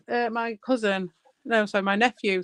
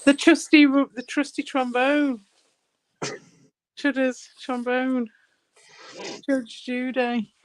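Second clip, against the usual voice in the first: slow, drawn-out nicknames; teasing a friend's voice alts, slow drawl; 2 semitones higher, slower than usual. Real, not acted. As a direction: savour each nickname slowly.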